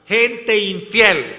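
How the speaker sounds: "Gente infiel" slow and loud, hit on "Gente"; slow emphatic quote. Retold or imitated. Apostle condemning the unfaithful, slow emphatic "Gente infiel". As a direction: drag out "Gente infiel" with weight. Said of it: "dice él".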